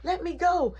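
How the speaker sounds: put-on high, pleading girlfriend voice; mimicking her plea. Girlfriend pleading to be let go, high. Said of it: "She like".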